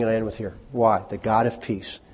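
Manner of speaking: usual speaking voice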